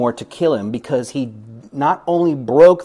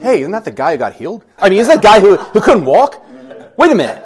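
fast, rising, then louder and higher in surprise; townsfolk surprise; 5 semitones higher, 9 dB louder, faster than usual. Retold or imitated. Townspeople spotting the healed man, surprised and rising. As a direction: catch yourself and realise who it is. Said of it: "And they're like".

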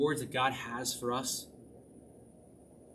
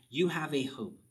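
usual speaking voice

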